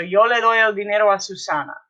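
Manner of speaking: quick, connected Spanish sentence; brisk full-sentence read-through; run together. Shown as a demonstration. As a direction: say it all in one fast flow. Whole sentence run together after slow take.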